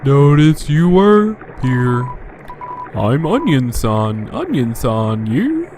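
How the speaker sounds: put-on slow, childlike elf voice; lightly voiced Christmas elf; Norwal from Elf voice. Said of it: "Norwal from Elf voice".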